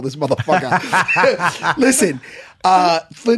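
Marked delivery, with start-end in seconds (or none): 0.0-1.8 laughing tease. Real, not acted. Teasing Jimmy while laughing.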